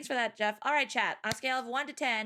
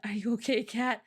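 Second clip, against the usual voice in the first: ordinary delivery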